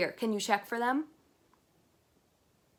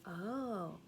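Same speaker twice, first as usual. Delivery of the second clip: plain reading voice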